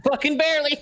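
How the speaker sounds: high, loud relieved yell; excited yelp. Real, not acted. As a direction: shriek it out in near-miss relief. Relieved after surviving a claw.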